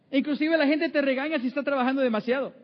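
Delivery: normal talking voice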